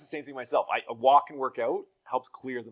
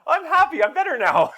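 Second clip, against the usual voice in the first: put-on much higher and louder kid voice, chirpy; happy daughter; 13 dB louder than usual. Daughter telling dad she feels better, high loud kid voice. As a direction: play the child bouncing back. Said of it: "And they're".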